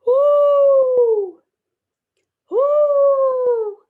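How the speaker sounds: loud high trumpeting call; imitating an elephant for preschoolers. Shown as a demonstration. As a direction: trumpet like an elephant, full blast. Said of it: "That's not the best trumpeting that I've done".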